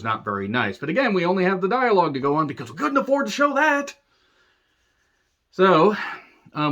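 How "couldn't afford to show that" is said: high-pitched mock exclamation; mocking outburst. Reviewer mocking the film's cheap excuse, high mock exclamation. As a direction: squeal the excuse in mock exasperation.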